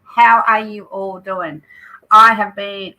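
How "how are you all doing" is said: ordinary talk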